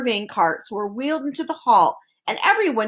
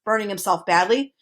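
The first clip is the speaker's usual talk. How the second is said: ordinary delivery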